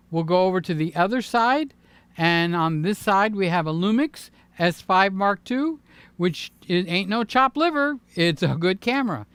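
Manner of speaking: normal talking voice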